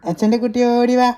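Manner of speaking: high, loud, sing-out call. Real, not acted. Father calling baby to come. (കുഞ്ഞിനെ ഉറക്കെ നീട്ടി വിളിക്കുന്നു)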